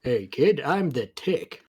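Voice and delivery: lightly put-on cartoon hero voice; slow down and lift the name; the Tick. Introducing himself to a kid as the Tick, lightly put-on hero voice. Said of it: "the Tick from that one show".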